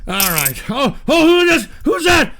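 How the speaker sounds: usual speaking voice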